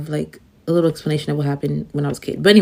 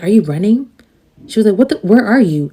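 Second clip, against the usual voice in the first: a friend's startled questions, pitched up and louder; alarmed friend; 4 semitones higher, 7 dB louder than usual. Retold or imitated. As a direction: sound alarmed on the phone.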